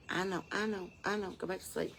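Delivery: quiet cooing reassurance; hushed soothing. Real, not acted. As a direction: lull it back to sleep. Soothing sleepy baby turkey.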